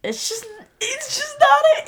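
exasperated squeal. Pretend. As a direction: squeal it out in hammed-up despair. Exasperated verdict on least favorite episode.

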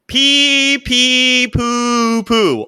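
very slow, loud, high sustained syllables; comically held notes, read at full volume; drawn-out comment reading. Acted. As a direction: stretch each silly word out long and high. Reading silly Twitter comments aloud.

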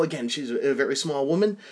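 normal talking voice

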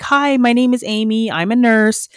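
high, loud self-introduction; calling over speakerphone. Retold or imitated. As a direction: announce yourself over someone talking. Introducing herself over speakerphone commotion.

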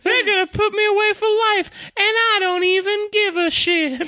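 high squeaky defiant elf voice; tiny bug not caring at all; little elf voice. Retold or imitated. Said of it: "a little elf voice".

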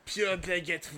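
hammed-up French accent; exaggerated Frenchman accent; mock French accent. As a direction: put on a thick comic French accent. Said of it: "That's a great accent".

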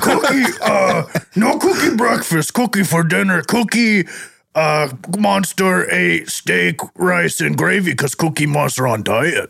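gruff laughing monster voice; Cookie Monster voice. Acted. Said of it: "for playing the Cookie Monster".

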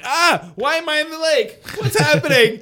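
high, loud panicked cry; a kid waking up in a lake, startled; mock panic.

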